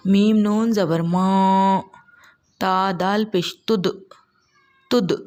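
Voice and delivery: slow, loud spelling with qalqala on दाल; slow tajweed recitation. Shown as a demonstration. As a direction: recite each syllable slowly with a bounce on the d. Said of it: "आवाज़ लौटेगी पढ़ते वक्त".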